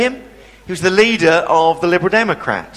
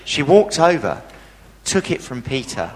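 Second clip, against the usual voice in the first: ordinary delivery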